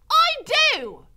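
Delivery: very loud, high yell; shouting. A narrator's reading. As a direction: shout it when asked to whisper. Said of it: "shouted Mig".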